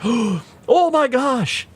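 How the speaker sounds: high, drawn-out mock astonishment; acted amazement. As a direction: stretch it out high, imitating a thrilled friend. Acts out colleague's delighted reaction.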